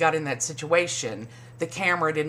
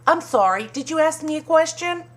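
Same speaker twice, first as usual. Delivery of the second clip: higher, snotty mock of a cast member; mock Ramona; 11 semitones higher, 5 dB louder than usual. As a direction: toss it off with fake politeness. Mocking Ramona's snippy reply.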